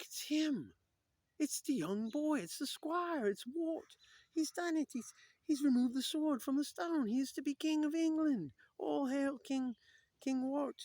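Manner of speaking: high, loud put-on proclamation; awestruck crowd. Voices awed crowd hailing new king.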